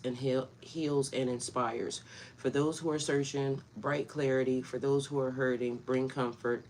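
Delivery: soft, low reverent prayer voice. Acted. Vlogger leading her viewers in prayer, soft and reverent. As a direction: pray it gently and humbly.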